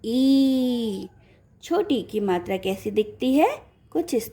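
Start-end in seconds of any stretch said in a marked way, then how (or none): 0.1-1.1 ई drawn out long and level. Shown as a demonstration. Teacher naming the big letter to children, long drawn-out ई. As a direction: stretch ई into a long vowel. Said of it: "बड़ी".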